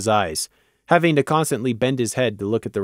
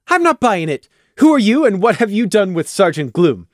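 high, louder mock accusation; playful demand; 7 semitones higher, 4 dB louder than usual. Taffy teases Gloom, high and mock accusing. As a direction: demand an answer in jest. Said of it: "Taffy demanded".

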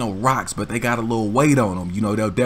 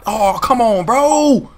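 high, louder oh come on; exasperated; 11 semitones higher, 6 dB louder, slower than usual. Real, not acted. Teller correcting his own mistake, high exasperated protest. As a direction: protest to yourself at the slip. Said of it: "That's definitely not my fault".

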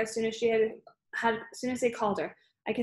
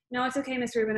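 plain reading voice

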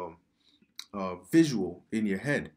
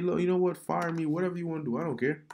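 defiant fed-up worker; 7 semitones higher than usual. Retold or imitated. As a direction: throw it back at the boss, done with it. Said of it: "They're gonna be like".

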